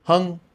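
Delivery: "hung" said high, loud and clear like English hung; Vietnamese name demo. Shown as a demonstration. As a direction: model "hung" for students to copy. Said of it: "just like hung in English".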